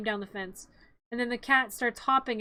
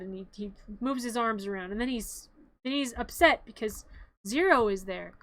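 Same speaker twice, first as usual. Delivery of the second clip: ordinary talk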